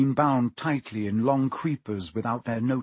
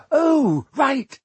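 sheepish realization; 13 semitones higher, 7 dB louder than usual. A narrator's reading.

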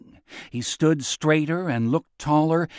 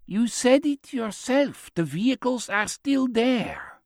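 put-on high-pitched, measured old man's voice; insistent old man; 10 semitones higher than usual. Old man correcting his companion, insistent. Chaim's figure voice in dialogue.

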